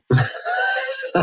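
sudden high-pitched laugh at own joke. Real, not acted. Cohost laughing at his own Schrodinger pun, high laugh. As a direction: burst into a squeaky laugh.

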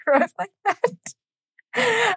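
high, squeaky talking through laughter; laughing hard. Real, not acted. Imagining old letters in slang, laughing hard. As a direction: barely get it out for laughing, slowly.

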